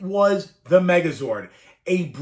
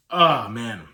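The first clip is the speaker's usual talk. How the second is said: choked up; 8 semitones lower than usual. Real, not acted. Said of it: "Why am I getting emotional about this?".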